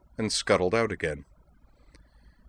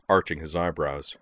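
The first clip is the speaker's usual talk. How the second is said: ordinary delivery, nothing marked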